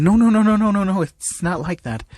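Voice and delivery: high, rapid-fire string of denials; flustered, blushing. A narrator's reading. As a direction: deny it in an embarrassed rush. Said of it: "As Ochako blushing".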